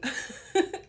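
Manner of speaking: high-pitched giggle; real laugh. Real, not acted. Vlogger amused at her own constant crying, high giggle. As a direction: break into a high little laugh. Laughs at how much she cries.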